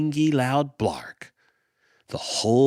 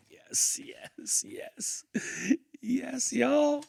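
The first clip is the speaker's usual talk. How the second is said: breathless delighted whisper; whispered yes; 14 semitones higher, 8 dB quieter, much slower than usual. Real, not acted. Delighted reaction after reading.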